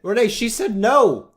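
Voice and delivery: high, loud mock scolding; comic background shouting; yelling off the phone. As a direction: holler it across the room. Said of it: "yelling from, like, off the phone".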